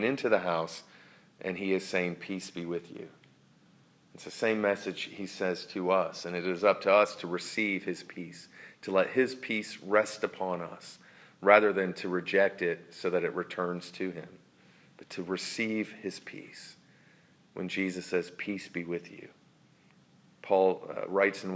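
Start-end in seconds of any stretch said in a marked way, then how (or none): 2.2-3.1 hushed, gentle blessing; a soft murmured peace be with you; soft greeting. Acted. Jesus' greeting voiced softly. 18.3-19.3 hushed, gentle blessing; a soft murmured peace be with you; soft greeting. Acted. Jesus' greeting voiced softly.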